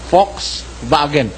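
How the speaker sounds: slow, low "Volkswagen" as Folks-vagen; "Volkswagen" with an F start and a V in the middle; German pronunciation. Shown as a demonstration. Preacher repeating the German way for listeners, German pronunciation. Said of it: "pronunciation diyan ng mga mismong Aliman".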